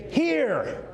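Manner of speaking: "here" alone, high then falling; high, emphatic "here" with a long fall; stress on HERE. Shown as a demonstration. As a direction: punch a drawn-out, high "here". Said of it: "put my emphasis on it here".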